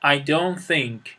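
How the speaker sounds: slow, clear teacher model of the negative. Shown as a demonstration. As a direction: say it slowly for the class. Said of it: "در حالت منفی".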